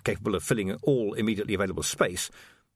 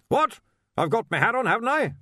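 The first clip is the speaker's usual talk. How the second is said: loud, high, blustering archchancellor; blustery old wizard; 12 semitones higher, 4 dB louder than usual. Acted. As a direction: demand it like a bluff old master.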